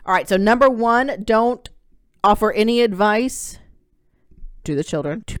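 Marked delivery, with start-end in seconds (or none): 0.1-3.6 loud, punchy, each word pressed; host rereads the rule firmly for listeners, punching each word; emphatic restatement. Real, not acted. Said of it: "restating advice emphatically".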